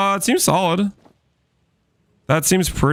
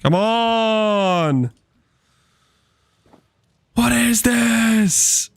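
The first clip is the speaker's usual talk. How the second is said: long drawn-out exasperated wail; exasperated complaint; 4 semitones higher, much slower than usual. Real, not acted. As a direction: stretch every word out in protest. Exasperated at a bad game result.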